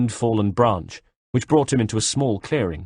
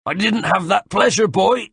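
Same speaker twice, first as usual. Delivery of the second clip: high, loud taunt over the shoulder; lightly voiced sneering villain calling back; a hint of a gloating soldier; called back mocking; 11 semitones higher, 4 dB louder than usual. A narrator's reading. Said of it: "he called back over his shoulder".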